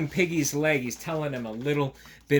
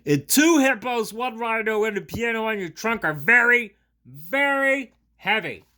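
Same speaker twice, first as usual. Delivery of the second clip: straining shout; 9 semitones higher, 9 dB louder than usual. Acted. Gerald groans under animals, strained shout. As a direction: bellow under the weight.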